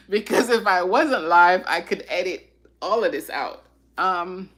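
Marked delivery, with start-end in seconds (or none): 0.1-1.6 real embarrassed laugh into the words; louder, laughing at yourself; laughing through tears. Real, not acted. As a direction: laugh it off while emotional. Laughing at own tears on live.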